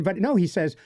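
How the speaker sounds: usual speaking voice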